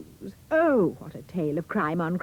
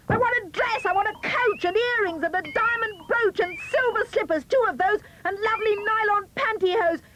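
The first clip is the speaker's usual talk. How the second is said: shouting demands; 13 semitones higher than usual. A narrator's reading. Cindy demands the ball from the fairy, high spoilt shout. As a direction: demand it like a bratty girl.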